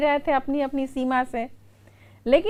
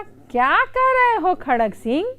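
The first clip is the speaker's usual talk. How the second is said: higher, insistent protest; indignant objection; 7 semitones higher, 3 dB louder than usual. Retold or imitated. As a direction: contradict him, sure you are right. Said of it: "तो कड़क सिंह ने कहा". (ऊँची आवाज़ में नाराज़ विरोध)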